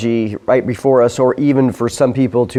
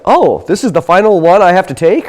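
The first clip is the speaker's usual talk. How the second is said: higher, louder imitated realization; mock surprised reaction; 6 semitones higher, 5 dB louder than usual. Voicing people's reaction to the mark.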